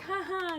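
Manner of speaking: high-pitched fake "ha ha"; spoken laugh at a corny joke; put-on high little laugh; mock laugh. Mock laugh at knitters' pun.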